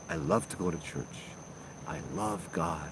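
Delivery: imitating the patient's low, soft churchy voice. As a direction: drop into a hushed devout murmur. Patient's voice in retold story.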